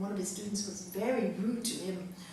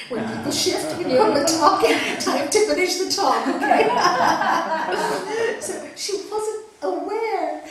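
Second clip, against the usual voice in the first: laughing; 9 semitones higher, 13 dB louder than usual. Real, not acted. Teacher laughing at own advice.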